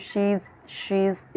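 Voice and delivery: "she is" run together into one quick "she's"; joined she is. Shown as a demonstration. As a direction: link "she is" smoothly, almost "shiz". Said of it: "she is को कैसे पढ़ रही हूँ".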